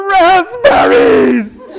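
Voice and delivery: very high, loud, drawn-out wailing shout; child's outraged shout. Retold or imitated. Son sees raspberries in his porridge, shrieking outraged shout. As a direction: scream it like a furious little boy. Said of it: "he goes".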